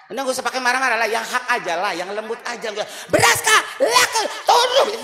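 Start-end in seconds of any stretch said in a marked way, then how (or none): 3.1-4.9 very high, loud nagging with a laugh; shrill demand. Retold or imitated. Wife shrilly nagging husband about rice, very high and loud. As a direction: nag him high and shrill, hammed up.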